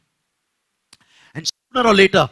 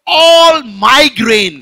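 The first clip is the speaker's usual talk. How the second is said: builds to a loud slow shout, much higher; rich fool shouting; 6 semitones higher, 11 dB louder than usual. Acted. The rich fool shouting over his barns, building to a slow shout. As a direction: shout the boast to the sky. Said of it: "That's what he said".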